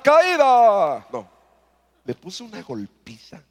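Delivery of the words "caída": agudo y fuerte como narrador de lucha libre; anunciador de lucha. Pretend. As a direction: anuncia la primera caída como en la arena. (cronista de lucha libre anunciando la caída)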